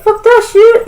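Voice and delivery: sudden high, loud outburst; mock furious Spawn. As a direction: bark it out as the enraged Spawn. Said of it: "and he's like".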